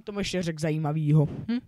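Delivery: loud. Real, not acted. Gamer musing aloud to viewers, half-yelling. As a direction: raise it to a near shout. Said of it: "že to tak řvu".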